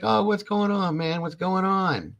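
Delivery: imitating a high, sing-out hello; friendly greeting. Brother returns the greeting at Walmart, friendly. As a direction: pitch up in a mock chummy reply.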